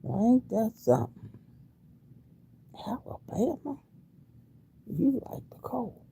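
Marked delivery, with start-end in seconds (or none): 2.9-6.0 high, soft, breathless whisper of amazement; wondering whisper to herself. Real, not acted. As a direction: hush it like a marvel. Repeats line, then whispers it.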